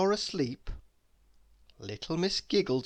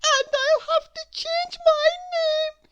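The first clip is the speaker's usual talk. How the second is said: very high, loud tearful voice; sobbing little girl; 8 dB louder than usual. Acted. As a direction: wail it through tears. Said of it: "she sobbed".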